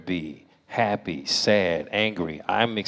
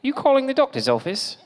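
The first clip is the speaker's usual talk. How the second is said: high, loud put-on receptionist; piercing mock receptionist greeting; prank phone voice; 5 dB louder than usual. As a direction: answer the phone in a silly high voice. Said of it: "I'd be like".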